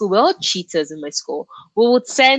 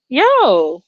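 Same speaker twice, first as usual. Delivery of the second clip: calling out; 6 semitones higher, 6 dB louder than usual. Real, not acted. Calling out to chat.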